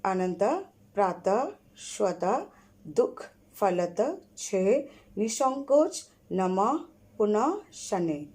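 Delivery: visarga words read one by one, final breath sounded; spaced, deliberate reading of each word; word-list drill. Shown as a demonstration. Teacher reading visarga words aloud to pupils. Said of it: "फिर विसर्ग वाले पढ़ रहे".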